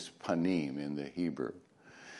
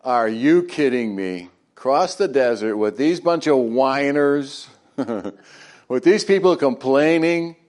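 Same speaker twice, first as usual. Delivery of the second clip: Moses complaining; 3 semitones higher, 7 dB louder than usual. Retold or imitated. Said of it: "This is Moses going".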